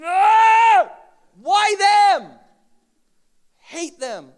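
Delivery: sudden high shout of envy; jealous outburst. Acted. Preacher acting out envy, a jealous shout. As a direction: explode at someone else's blessing.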